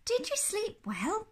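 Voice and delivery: high, lilting question; cheery Missus Bear. Acted. Rested wife asks sleepless husband, high cheery lilt. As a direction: ask it high and innocently.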